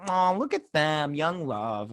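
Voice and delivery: drawn-out, high "aw" of adoration; slow, gooey coo; cooing over a couple. Retold or imitated. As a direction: gush like they're adorably sweet. Gushing over cute couple.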